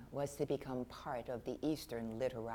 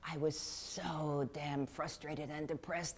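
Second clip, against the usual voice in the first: ordinary talk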